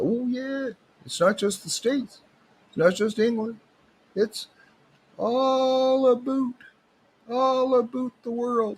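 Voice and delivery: high-pitched, hammed-up Southern Ontario local; Southern Ontario accent. Local guy correcting the doubter, hammed-up Southern Ontario accent. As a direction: put on a high Southern Ontario accent, stretching "all about". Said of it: "That's my best Southern Ontario accent".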